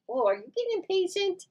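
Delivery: rising mock-outraged pet voice. Real, not acted. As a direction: coo at the impatient dog in a high voice. Talking to the dog.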